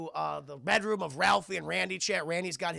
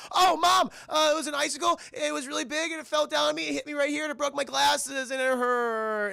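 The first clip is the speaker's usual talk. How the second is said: high, whining mock sobs; fake-crying kid; 8 semitones higher, 5 dB louder than usual. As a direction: wail a made-up excuse to mom. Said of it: "He starts crying and everything".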